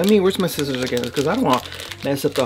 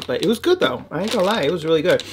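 ordinary talk

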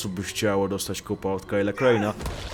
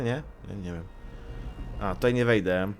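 normal talking voice

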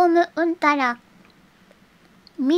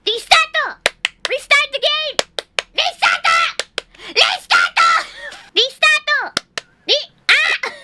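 high, loud squealing command; frustrated shouting; 10 semitones higher, 5 dB louder, slower than usual. Real, not acted. Streamer demanding restart from laggy game, frustrated high shout.

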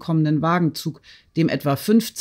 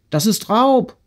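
higher-pitched, mildly outraged exclamation; indignant accusation; 7 semitones higher, 3 dB louder than usual. A narrator's reading. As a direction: accuse him, rising in indignation. Retold accusation by an official. (empört beschuldigend)

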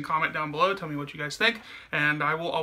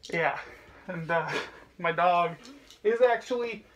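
speaking inside full face helmet; muffled through helmet; 5 dB quieter than usual. Real, not acted. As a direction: voice behind plastic faceplate. Seen 0: Toy helmet covers his whole face and mouth while speaking.

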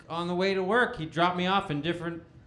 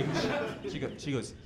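ordinary talk

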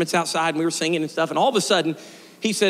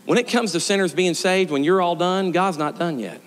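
usual speaking voice

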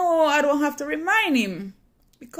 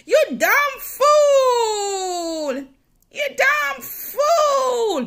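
much higher and louder, very slow tearful yell; cursed shout; 13 semitones higher, 8 dB louder, much slower than usual. Retold or imitated. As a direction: hurl the curse at her full force. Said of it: "she cursed me bad".

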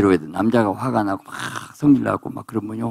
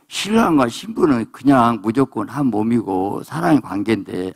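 usual speaking voice

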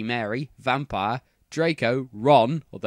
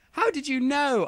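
high-pitched surprised question; 13 semitones higher than usual. A narrator's reading. Heroine questioning Voldemort, surprised. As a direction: ask it in open amazement. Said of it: "I asked in a surprised way".

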